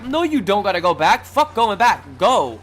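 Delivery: high, loud, urgent objection; acted yelled argument. Passenger orders driver to keep going, yelling urgently. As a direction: yell the driver down.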